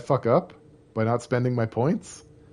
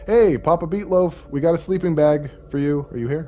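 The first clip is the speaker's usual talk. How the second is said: loud, high call across the woods; 9 semitones higher, 10 dB louder than usual. Acted. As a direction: shout out to find him. Calling out to a character.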